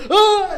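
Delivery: very high, loud whoop; mock seizure cry. Funeral speech broken by laughter, mock seizure shriek. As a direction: burst into an imitated high seizure yelp. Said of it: "someone laughs and he goes".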